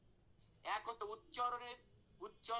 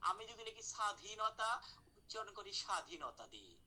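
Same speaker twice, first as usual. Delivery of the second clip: usual speaking voice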